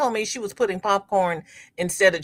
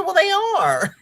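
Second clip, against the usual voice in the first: high-pitched insistent retort; defiant comeback; 13 semitones higher, 3 dB louder than usual. Retold or imitated. Mother defending her stares to daughter, high insistent retort. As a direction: shoot back in a high voice. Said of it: "I said".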